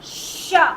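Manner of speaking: शा breathed out unvoiced through closed teeth; whispered sha. Shown as a demonstration. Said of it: "दांत आपस में बंद करके पढ़ रहे हैं".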